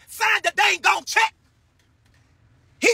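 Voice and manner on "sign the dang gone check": slow, high, shouted; shouted declaration. Retold or imitated. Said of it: "when God say".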